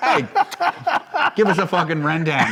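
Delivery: mock English accent. Comedian plays friend's dad ordering food, mock English accent. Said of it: "I'm only doing this accent".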